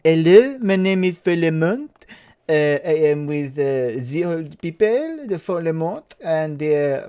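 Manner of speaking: hammed-up French accent, halting. Forlemont introducing himself, put-on French accent. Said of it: "I'm choosing to go French".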